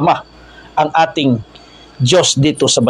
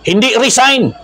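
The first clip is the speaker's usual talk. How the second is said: slogan cry; 9 semitones higher than usual. Shown as a demonstration. Preacher shows protesters what not to shout, slogan cry. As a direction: call out the rejected slogan, voice raised in pitch. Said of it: "Yan dapat ang kanilang isigaw".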